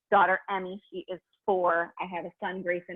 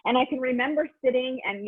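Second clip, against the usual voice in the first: ordinary delivery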